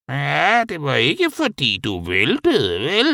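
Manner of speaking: high-pitched, drawn-out creature grumble; muttering Henrietta. A narrator's reading. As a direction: mutter it high and slow, stretching the words. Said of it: "mumlede Henrietta".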